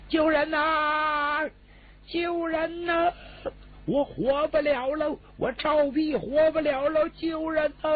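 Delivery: high-pitched desperate cries for help; crying out for help. Acted. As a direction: wail for rescue at the top of the voice. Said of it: "这赵壁敞开嗓子就叫唤开了".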